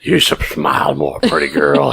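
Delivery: low, breathy creep; creepy voice. Shown as a demonstration. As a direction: leer it out like a creep. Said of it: "I'm gonna do the creepy voice".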